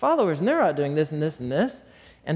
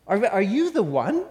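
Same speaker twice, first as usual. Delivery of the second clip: doubting question; 4 semitones higher than usual. Acted. Acting out John's doubt.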